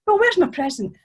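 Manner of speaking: put-on young man losing patience; indignant outburst. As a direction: finally burst out with it. Tom finally demands his gift.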